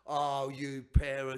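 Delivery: loud, slow telling-off; drawn out and loud like a mum catching pranksters; half-amused loud reproach; mock scolding.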